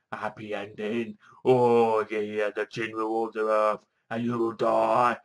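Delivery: slow, drawn-out villain monologue; hammed-up wrestler rant, every word stretched; Ultimate Warrior. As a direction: gloat slowly like a mock supervillain. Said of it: "listening to the Ultimate Warrior from W W".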